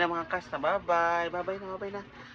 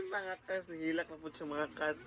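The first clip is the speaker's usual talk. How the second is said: much softer aside; hushed; 2 semitones lower, 14 dB quieter, faster than usual. Real, not acted. Parent tells viewers quietly the baby is crying.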